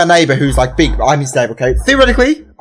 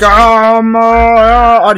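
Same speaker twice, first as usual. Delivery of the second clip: stalling monster; 4 semitones higher, 3 dB louder, much slower than usual. Acted. Monster stalls, inventing a name.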